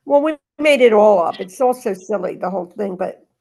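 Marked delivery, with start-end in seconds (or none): none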